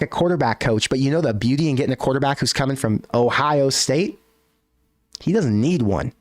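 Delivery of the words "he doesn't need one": "need" punched with a pitch swing; stress on need. Real, not acted. Host making his point about the quarterback from Ohio State, stress on "need". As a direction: lean hard on the word "need". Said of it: "Emphasis on the word need".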